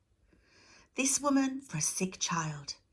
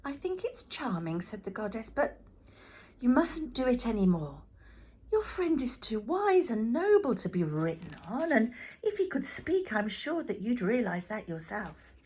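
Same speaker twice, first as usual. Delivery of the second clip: gentle goddess; 5 semitones higher, 5 dB louder than usual. Acted. Goddess gently chides boy.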